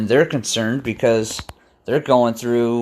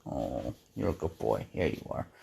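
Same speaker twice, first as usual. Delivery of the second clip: much lower and softer, tender praise to the cat; pet talk; 9 semitones lower, 15 dB quieter than usual. Real, not acted. Praising his cat softly.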